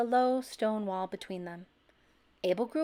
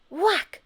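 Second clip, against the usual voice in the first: high, loud whack; whack sound effect; 10 semitones higher, 8 dB louder than usual. A narrator's reading. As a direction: smack it out. Sound word in story.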